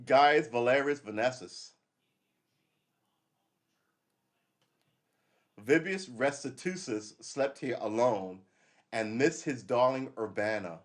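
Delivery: slow, grand proclamation of an ancient inscription; announcing a Roman soldier's boast; slow formal reading. Shown as a demonstration. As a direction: read it out like a carved epitaph. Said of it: "reading inscription".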